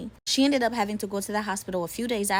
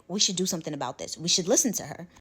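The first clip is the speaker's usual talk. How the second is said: ordinary talk, nothing marked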